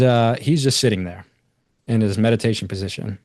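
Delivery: ordinary talk, nothing marked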